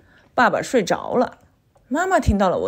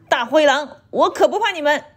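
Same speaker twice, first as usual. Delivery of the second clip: bold threat; 4 semitones higher, 3 dB louder than usual. A narrator's reading. Mother shows how to threaten wolves.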